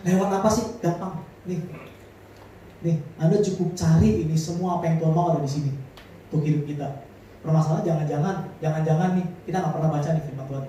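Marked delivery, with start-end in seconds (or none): none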